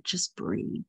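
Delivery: breathy whispered cue. Acted. Guide whispering to the relaxing listener, hushed.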